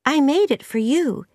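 put-on high girl's voice; eager young Anne. A narrator's reading. Anne presents her cake to Missus Allen, high and eager. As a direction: say it sweetly, pitched high.

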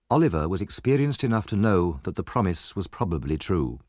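usual speaking voice

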